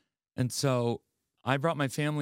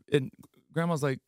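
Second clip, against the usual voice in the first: usual speaking voice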